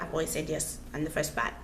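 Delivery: usual speaking voice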